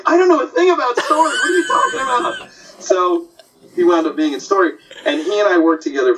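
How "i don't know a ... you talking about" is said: high, fast, laughing disbelief; incredulous retold protest. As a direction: squeal it out in amazed protest. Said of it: "And he's like".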